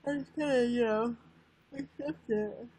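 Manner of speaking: talking through a yawn; words stretched by a yawn. Real, not acted. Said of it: "you're making me yawn".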